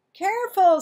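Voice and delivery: high, loud warning; warning call. A narrator's reading. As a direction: call out a sharp caution. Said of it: "said Missus North".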